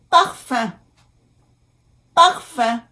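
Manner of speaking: "Parfum" in French, nasal ending, silent m; careful French pronunciation. Shown as a demonstration. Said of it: "U M".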